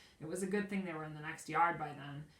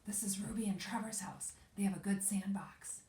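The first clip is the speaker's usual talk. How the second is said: breathy secret whisper; 5 semitones higher than usual. A narrator's reading. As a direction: whisper it so no one hears. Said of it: "Bean whispered".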